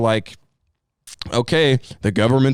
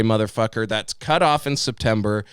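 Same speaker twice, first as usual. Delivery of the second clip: ordinary talk, nothing marked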